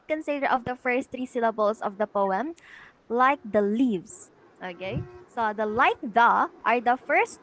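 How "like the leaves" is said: slow "like the leaves" landing hard on "leaves"; drawn-out "like the leaves", strong beat on "leaves"; last syllable stressed. Shown as a demonstration. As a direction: lean into "leaves" after a lighter "like the". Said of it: "it is the stressed syllable".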